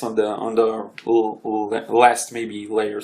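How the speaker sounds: stuttering, slow and halting. Real, not acted. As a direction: stumble over the first sound. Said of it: "Sorry, I'm stuttering a bit".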